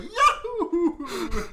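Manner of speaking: very high, loud joyous whoop; Mario. Retold or imitated. Butler blasting orchestra out the car window, Mario-style whoop. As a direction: whoop it like a cartoon game hero. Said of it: "sounds like Mario".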